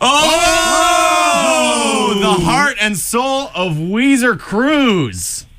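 big drawn-out hype welcome; excited announcer. Acted. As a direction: greet him like a grand arrival. Host greets caller with fanfare.

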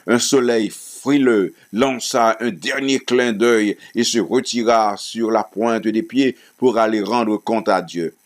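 plain reading voice

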